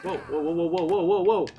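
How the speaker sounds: rising alarmed whoas, climbing high; startled whoa. Real, not acted. Losing control of her game character, a startled rising whoa. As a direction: caught off guard as the game runs away from her.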